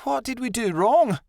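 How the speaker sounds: high, plaintive question; upset. A narrator's reading. Adelaide wonders why her friend left, upset and plaintive. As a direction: plead for an answer in a high voice.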